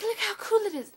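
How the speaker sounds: high mocking show-off voice; mock boasting. Mimics boastful Android owners.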